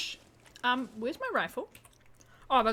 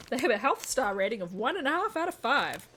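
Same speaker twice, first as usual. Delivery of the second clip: mock outrage; 4 semitones higher, 6 dB louder than usual. Real, not acted. Reads the rating, outraged.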